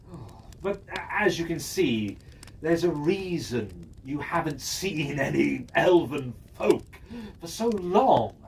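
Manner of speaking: usual speaking voice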